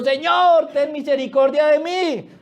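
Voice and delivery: high-pitched mock wail for mercy; imitated crying out. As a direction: mimic a nagging woman calling out. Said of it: "gritando y gritando". (grito de súplica imitado, muy agudo)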